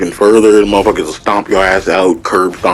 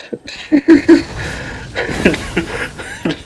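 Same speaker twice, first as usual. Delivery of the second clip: incredulous laugh; 3 dB quieter than usual. Real, not acted. Disbelief at the apology.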